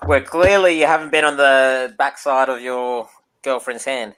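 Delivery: ordinary talk, nothing marked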